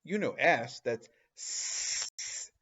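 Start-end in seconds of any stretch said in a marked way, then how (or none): 1.4-2.5 a long hissing s, like a snake; hissed s sound. Shown as a demonstration. Teacher demonstrating a snake-like s sound. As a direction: hiss the s like a snake. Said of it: "like snake".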